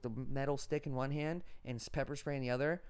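ordinary talk

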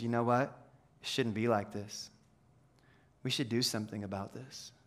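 low, soft, reflective quote; hushed. Retold or imitated. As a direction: drop right down, almost to yourself. Hushed retelling of someone stepping up.

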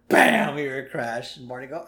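ordinary talk

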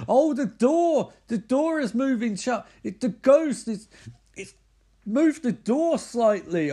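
high, loud hammed-up panic; mock alarm. Teller reacts to the ghost moving the door, hammed-up alarm.

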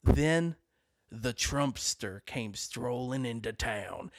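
slow, low, hushed gunslinger intro; Western drawl. Retold or imitated. Cowboy pose, dramatic announcement.

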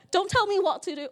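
imitating a touchy comeback; husband pushing back; mock defensive. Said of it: "Arby does that to me".

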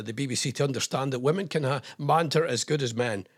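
ordinary talk, nothing marked

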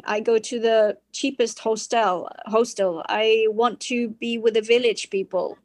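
normal talking voice

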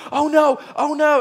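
high, panicked cry of oh no; a kid realizing disaster. Retold or imitated. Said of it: "Smalls is freaking out".

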